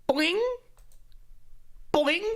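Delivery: high springy jump boing; high bouncy boing sound; cartoon boing. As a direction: mimic Mario's jump. Mario jumping sound effect.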